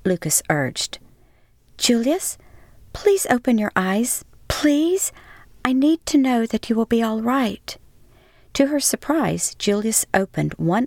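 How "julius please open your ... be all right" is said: Miriam pleading over a wounded man; pleading woman. Acted. Pleading with injured Julius.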